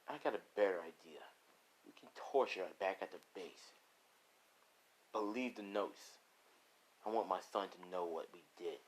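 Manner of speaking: low, quiet menace; hushed, sinister boss voice; scheming villain. Acted. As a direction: lay out a cruel plan softly. Villain plots the torture.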